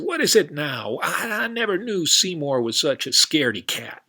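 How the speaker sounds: cowboy fed up with his horse, high and loud; exasperated cowboy. Acted. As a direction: throw up your hands like a cowboy.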